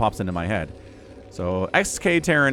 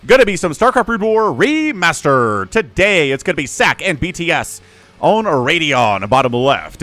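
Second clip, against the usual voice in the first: hype announcer intro; 3 semitones higher, 8 dB louder than usual. Acted. Caster greeting StarCraft fans, loud hype announcer. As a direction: belt out the welcome to the fans.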